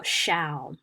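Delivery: shall cut off as the lone first syllable of shower; first syllable alone. Shown as a demonstration. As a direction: say only the stressed opening syllable, shall, without the -er. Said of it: "the stress is on the first syllable".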